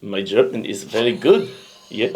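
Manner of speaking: comic fake German accent. Host showing friend his German, mock German accent. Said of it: "How's your German going?".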